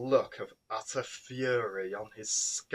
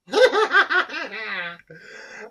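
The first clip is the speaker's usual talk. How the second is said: high, loud rising villain cackle; evil cackle; 11 semitones higher, 12 dB louder than usual. A narrator's reading. Vampire queen cackles at the captives, evil cackle. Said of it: "Nancy cackled with laughter".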